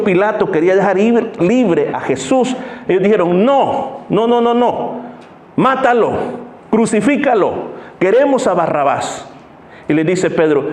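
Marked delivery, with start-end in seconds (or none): 3.4-9.3 angry crowd. Acted. Crowd demanding Jesus be crucified.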